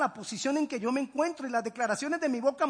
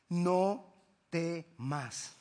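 drawn-out, tender "No temas"; soothing command; slow reassurance; 6 semitones lower, much slower than usual. Acted. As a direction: stretch it out like a comforting word. God tells them not to fear.

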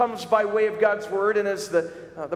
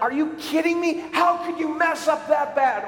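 high, shouted disbelief at a coworker; exasperated outburst; 8 semitones higher, 3 dB louder than usual. Retold or imitated. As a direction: yell it at whoever messed up. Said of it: "you're like".

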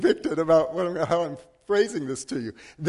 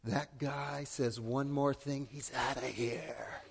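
softer, lower, slow umpire warning; stern low warning; 4 semitones lower, 11 dB quieter, slower than usual. Retold or imitated. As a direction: warn the coach quietly and firmly. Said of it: "marches over to our coach, and says".

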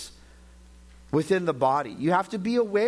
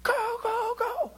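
high, strained sideline cheer, held back in volume; retold cheering shout; slower than usual. Father re-enacting his cheers at the wrestling tournament, mock high shout. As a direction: imitate cheering him on, pitched way up. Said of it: "'cause I was shouting".

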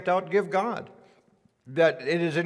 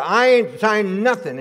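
angry refusal; 5 semitones higher, 7 dB louder, slower than usual. Retold or imitated. Angry customer refuses to sign.

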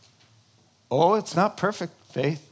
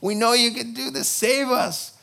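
pleading prayer; 8 semitones higher than usual. Acted. Israelites praying for rescue.

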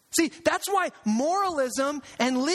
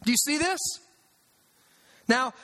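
ordinary talk

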